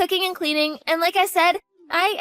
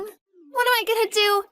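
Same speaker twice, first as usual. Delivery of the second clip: panicked; 5 semitones higher than usual. Acted. Daughter panics as father arrives.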